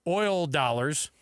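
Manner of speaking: ordinary talk, nothing marked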